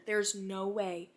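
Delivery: normal talking voice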